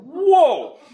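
loud, high-pitched outburst of disbelief; a big, startled whoa; shocked whoa. Retold or imitated. Customer taken aback by the phone agent's reply, shocked.

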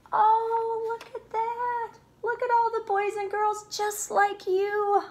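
high, excited exclaim; bright storytime delight. Acted. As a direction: show the kids the picture with delight. Excited about children picture.

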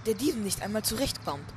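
ordinary talk

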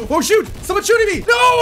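high, loud alarm building to "No!"; rising panic, loud and high; panicked yelling. Real, not acted. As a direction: yell out as you are being shot at. Suddenly shot at in game.

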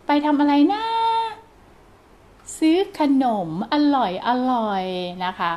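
put-on gently slowed, child-directed ไปทำอะไรนะ. Shown as a demonstration. Parent talking slowly to a child. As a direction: ease the words out for a little one. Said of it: "ก็ช้าลงอีกนิดนึง".

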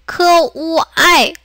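크우아이 split slowly into 크, 우, 아이; slow, separated syllables in 크우아이; sounded out separately. Shown as a demonstration. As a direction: break 크우아이 apart for learners. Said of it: "이것도 우리 분리해서".

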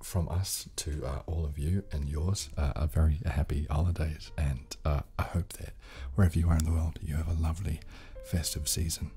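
ordinary talk

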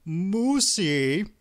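put-on high, whiny call of a pouting boy; petulant little boy. A narrator's reading. Hungry husband calling his wife, petulant little boy. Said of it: "called in the voice of a petulant, chubby little boy".

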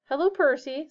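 ordinary delivery

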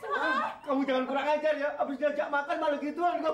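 high-pitched, indignant telling-off; upset scolding. Acted. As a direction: scold them with the voice pushed up. Boss scolds after the mishap.

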